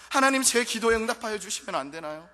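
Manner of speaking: loud, high crying out to God; desperate shouted prayer. Acted. Worshipper crying out to God, loud and high. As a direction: plead at the top of the voice.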